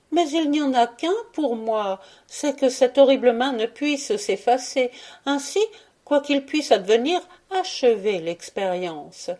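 a wife urging her husband to finish the experiment; Georgina, resolute wife. A narrator's reading. Georgina's voiced lines.

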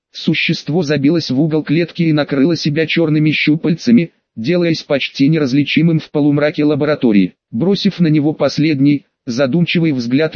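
ordinary delivery, nothing marked

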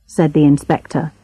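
usual speaking voice

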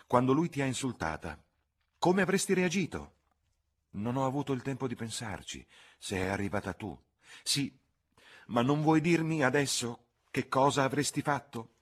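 pressing interrogation; insistent cousin. A narrator's reading. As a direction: demand an answer. Charlotte presses Lucy.

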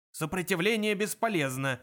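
emphatic drilled bark; roared. A narrator's reading. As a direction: bellow the slogan, somewhat restrained. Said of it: "взревел охранник".

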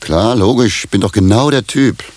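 sarcastic. Acted. Husband mocks wife's affair suspicion.

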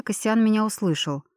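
ordinary delivery, nothing marked

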